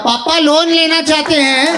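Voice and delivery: high-pitched public announcement in Hindi; telling the whole neighborhood. Acted. Child telling the whole mohalla about Papa's loan, high announcing call. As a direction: broadcast it high to every neighbor. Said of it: "go and tell everybody in the fucking mohalla".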